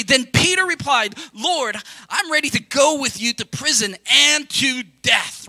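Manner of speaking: loud preached quote; preaching shout. Acted. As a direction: proclaim the verse at full force.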